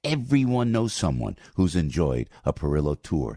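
upbeat radio ad read. Acted. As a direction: sell it like a host ad. Live host read of tour ad.

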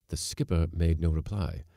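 ordinary delivery, nothing marked

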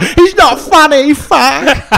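hammed-up slow drunk wail; imitating heckler. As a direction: stretch it out like a sulking drunk. Heckler raging in the lobby.

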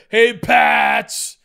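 loud hallway call of Hey Pats; yelled greeting. Retold or imitated. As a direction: shout it across the hall. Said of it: "I'd be like".

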